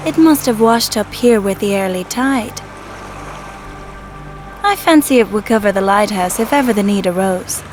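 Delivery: ordinary talk, nothing marked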